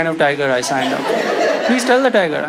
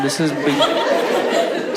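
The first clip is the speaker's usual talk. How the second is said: slow mock guide; 3 semitones lower, much slower than usual. Guide's voice, slowed.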